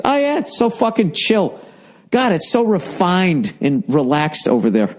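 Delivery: high mocking sarcasm; sarcastic. Mocking Europe's calm, high sarcastic sneer. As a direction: sneer it out, pitch way up.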